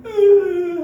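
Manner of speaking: loud, high mock wail; hammed-up child's sob; imitated crying. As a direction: bawl like a scolded little girl. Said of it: "하고 울어".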